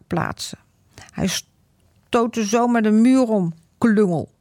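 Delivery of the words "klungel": ordinary delivery, nothing marked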